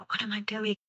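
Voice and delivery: whispered reply. Retold or imitated. Quoting gunman's reply.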